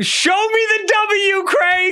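high-pitched comic yell, drawn out; mock outraged shout. Challenging Craig over pronunciation, mock outraged shout.

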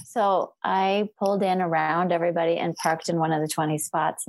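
normal talking voice

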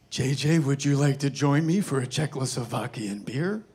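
lightly imitated veteran director's invitation; Clint Eastwood. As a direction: do a film legend's voice, ending on a rising question. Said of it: "I hear Clint Eastwood go".

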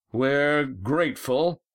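high, slow narrated shout. A narrator's reading. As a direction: draw the words out as a hail.

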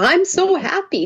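high, slow, happy gush; beaming with delight; excited. Real, not acted. New internet working.

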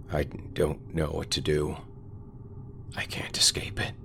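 soft, half-whispered despair; quiet hopeless confession. Acted. Carl despairing in the church.

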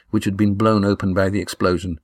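ordinary delivery

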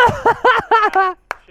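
high-pitched cackle; real giddy laughter; elated laugh. Real, not acted. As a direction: burst into a high, delighted laugh. Celebrating big donation.

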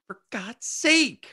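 high, drawn-out mock exasperation; pissed off. Premier hears it's a pandemic, hammed-up exasperation. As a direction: imitate a fed-up man at his limit. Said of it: "he's pissed about it".

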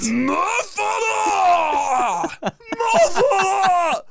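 high drawn-out furious scream; mock-angry shrieking, laughing. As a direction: yell it slowly and shrill at the camera. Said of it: "Just screaming at me".

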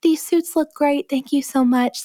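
high, sugary mock gratitude; overly sweet mock thanks; mock people-pleaser. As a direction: pitch up as a meek pushover. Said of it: "I shouldn't have just been like".

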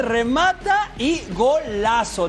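normal talking voice

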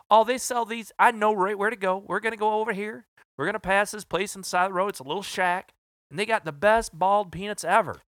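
hammed-up Southern sales rep, eager; southern drawl. Sales rep describing the roadside shack in a Southern drawl. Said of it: "He goes".

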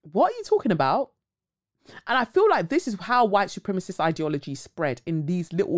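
usual speaking voice

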